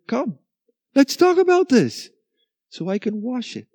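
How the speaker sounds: high, tender calling; God's invitation. Retold or imitated. God calling his people to come and talk. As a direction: invite them in gently. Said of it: "what he says in Isaiah".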